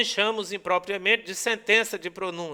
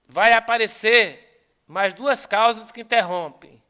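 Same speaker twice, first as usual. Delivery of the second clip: louder, driving the point home; emphatic; 2 semitones higher, 7 dB louder than usual. Real, not acted. Teacher telling students the key rule, louder and emphatic. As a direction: push this rule out to the room.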